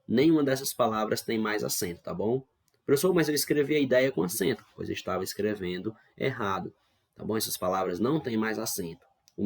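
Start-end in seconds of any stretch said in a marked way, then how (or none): 2.8-4.7 higher, quicker mock-student objection; protesting student. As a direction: pipe up like a student questioning the teacher. Teacher voices a protesting student. (imitando um aluno que contesta, voz mais aguda)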